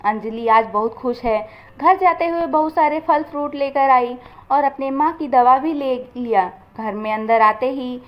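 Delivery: ordinary delivery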